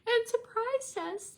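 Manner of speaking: retelling her own tearful words to her sister; crying voice. Retold or imitated. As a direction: replay the sob-talk from the restaurant. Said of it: "just crying".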